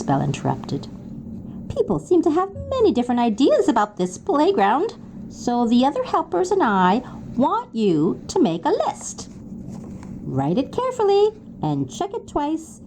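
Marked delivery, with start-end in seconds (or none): 1.7-12.8 lightly voiced small builder, pitched higher and friendly; a hint of a chirpy handyman; cheery little builder. Builder explains the list task to third graders, cheerfully.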